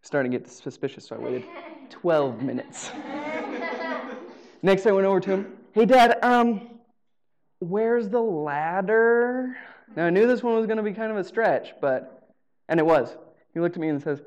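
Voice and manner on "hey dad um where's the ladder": put-on very slow, stretched, louder kid voice asking dad; drawn-out sneaky kid plea. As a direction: stretch every word, angling for the ladder. Kid asks dad for ladder.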